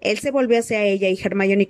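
usual speaking voice

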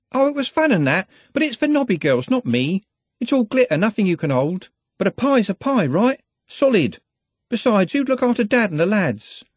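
put-on high, breathless girlish chatter; young girl Juliet. Juliet pours out her reasons to Glenda, high and eager. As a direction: run on like an earnest, eager girl.

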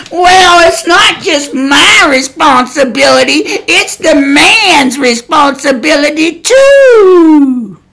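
mocking objector, drawn out with a long wailed final word; mock whiny protest. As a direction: whine it slowly, imitating an indignant excuse-maker.

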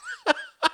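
shrieking laugh up high; high mocking cackle. A narrator's reading. Envoy laughing hard at Churchill. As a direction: cackle at him. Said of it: "He laughed again".

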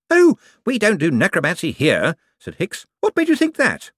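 high, eager, bright-voiced wizard; a jumpy official covering for himself. A narrator's reading. Hicks, eager department wizard.

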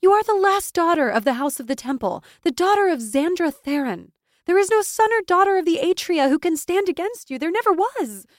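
loud, high rallying speech building in intensity; impassioned exhortation, mounting to a cry; gaining momentum. A narrator's reading. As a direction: drive it upward to a ringing peak. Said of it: "her voice gaining momentum with each syllable".

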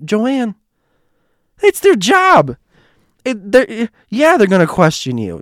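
high, loud, incredulous protest; retold exasperation. Narrator argues with Joanne about security, exasperated. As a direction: argue it loudly, pitch soaring.

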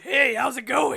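loud gravelly smoker's greeting; raspy smoker voice. Retold or imitated. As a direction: shout it like a heavy smoker. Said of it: "Rosie O'Donnell with a Pack-A-Day habit".

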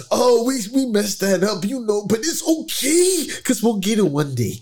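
playful put-on athlete's voice, lifting on "okay"; mock quarterback interview voice; imitated quarterback. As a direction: mimic a player shrugging off a mistake. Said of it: "when I heard him speak".

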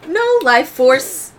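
loud, high, halting announcement; Kodo. Acted. Game master's character reporting after closing the vent, loud announcing. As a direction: announce it in slow, separated bursts.

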